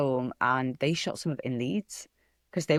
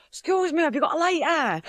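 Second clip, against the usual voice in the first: put-on Hull accent; 12 semitones higher than usual. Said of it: "such a distinct accent".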